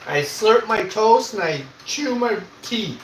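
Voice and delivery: mock-defiant boast to the troll; defiant slow boast. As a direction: drag it out, proud and cheeky. Boasting about the chewing.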